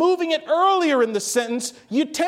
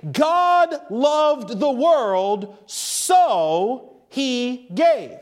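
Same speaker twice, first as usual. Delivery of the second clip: very slow verse, "so" set apart before "he gave"; slow, shifted emphasis; much slower than usual. Shown as a demonstration. Preacher moves so later in the verse, slow shifted emphasis. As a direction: weigh each word, holding "so" long. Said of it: "see how it changes the emphasis".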